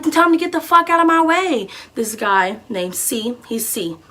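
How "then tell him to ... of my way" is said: retold yell. Shouting back from car.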